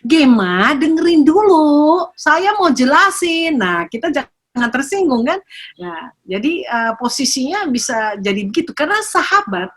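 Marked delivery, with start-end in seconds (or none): 0.1-3.6 high, slow mock-teacher voice of a grandchild; imitating a child teacher. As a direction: play the little teacher lecturing grandma. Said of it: "Tuh cucu saya jadi bapak guru". (meniru cucu yang jadi bapak guru)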